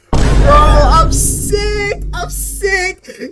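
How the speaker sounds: very high, slow, drawn-out indignant cry; outraged. Real, not acted. Reacting to the cruel orphan mom, high outraged wail. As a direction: let the outrage spill out high and loud. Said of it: "made me so mad".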